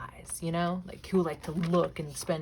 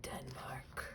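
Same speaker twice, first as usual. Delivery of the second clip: "Denmark" whispered, unvoiced and much softer; 5 semitones lower, 10 dB quieter than usual. Real, not acted. Whispering the country name to herself while reading. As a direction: breathe "Denmark" under the breath. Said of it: "I don't know why I said it like that".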